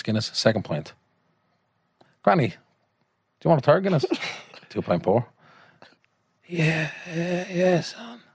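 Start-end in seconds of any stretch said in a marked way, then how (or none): 2.2-4.0 higher-pitched acted question to granny; coaxing call. Uncle calls to dying granny. 6.5-8.2 put-on high, thin old woman's voice; dying granny.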